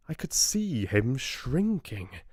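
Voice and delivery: plain reading voice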